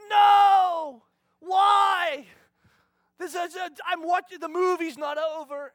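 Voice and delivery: put-on loud, high, shouting child refusing bed; whiny kid protest. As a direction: protest like a tired seven-year-old. Daughter refusing bedtime.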